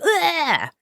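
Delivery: high, sharp grossed-out groan; disgusted ugh. Retold or imitated. Vlogger reacting to the gross ants scene, high disgusted ugh. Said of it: "that just had me like".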